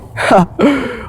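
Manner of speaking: a short high giggle at herself; embarrassed laugh. Real, not acted. Vlogger laughing at her own stumble, light giggle. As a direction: laugh off the fumbled words.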